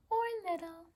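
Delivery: squeaky little-voice for small; tiny high voice. A narrator's reading. Reading size contrast.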